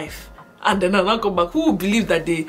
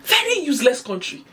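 higher, punchy mock outrage; acted indignant complaint; 6 semitones higher, 3 dB louder than usual. Man behind her at the airport as power cuts, acted indignation. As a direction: grumble loudly like a fed-up stranger.

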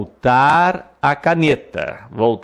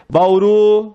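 Bauru higher and louder; high, loud emphasis; 8 semitones higher, 5 dB louder than usual. Shown as a demonstration. Teacher showing the class where the stress falls, high and loud. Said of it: "oxítona".

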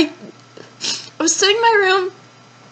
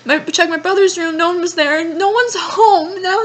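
ordinary talk, nothing marked

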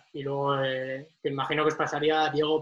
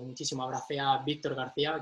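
normal talking voice

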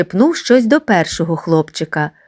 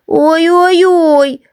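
high, slow wailing squeal; child squealing; 10 semitones higher, 4 dB louder, much slower than usual. Acted. Beaten boy squeals in pain, high and slow. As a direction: wail like a beaten little boy. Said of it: "верещав Гриць".

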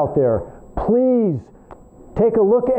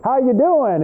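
higher, drawn-out hillbilly greeting; folksy hillbilly accent, pitched up; silly hillbilly accent; 4 semitones higher, slower than usual. Acted. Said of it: "had a little silly accent".

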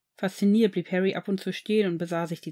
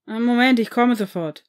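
shouted back from another room, much louder; calling back; 2 semitones higher, 7 dB louder than usual. Acted. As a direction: call it out from down the hall. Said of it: "rief eine Stimme zurück".

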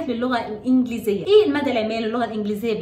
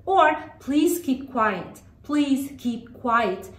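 very slow, drawn-out instruction with falling ends; slow model phrase; much slower than usual. Shown as a demonstration. Teacher models a classroom instruction for children, very slowly. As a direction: space out each word for learners.

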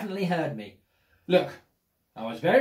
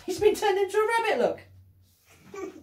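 delighted reveal; 13 semitones higher than usual. Acted. Reveal of rabbit transformation.

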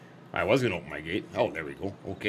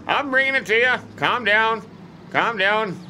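soothing the herd; 8 semitones higher, 3 dB louder than usual. Real, not acted. Reassuring impatient cows.

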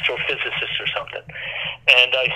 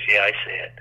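imitating a voice dropping lower, gone grave; low serious tone; 7 semitones lower than usual. NASA man seeing the tree, tone dropping lower. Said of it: "his tone kept getting lower".

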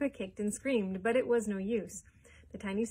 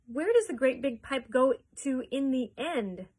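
louder, higher insistent question; shrieked question; 4 semitones higher, 7 dB louder than usual. A narrator's reading. Mother questioning the factory owner about the pipe, shrieking. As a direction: press the question, voice raised. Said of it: "shrieked Missus Salt".

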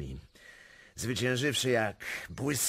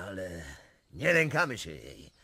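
ordinary talk

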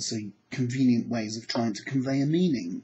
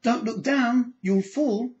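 high, anxious mum calling up; a mum warning her child on a climbing frame; worried mum; 8 semitones higher, slower than usual. Retold or imitated. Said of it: "Mum looks up and says".

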